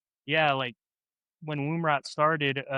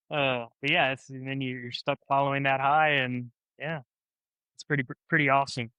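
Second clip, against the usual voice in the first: ordinary talk, nothing marked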